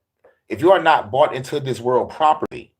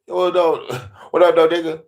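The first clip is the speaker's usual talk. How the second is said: 1 casual God voice; 5 semitones higher than usual. Acted. God voiced greeting Lucifer casually.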